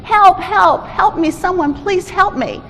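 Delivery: yelling for help. Acted. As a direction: plead up to whoever is passing. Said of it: "he started yelling again".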